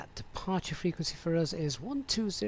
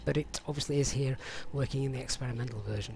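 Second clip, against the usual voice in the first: usual speaking voice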